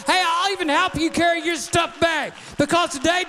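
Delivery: rising, shouted preacher cadence; a pastor rallying the church against the devil, preaching hard; full-force preaching. Acted.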